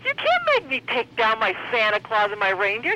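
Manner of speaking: ordinary delivery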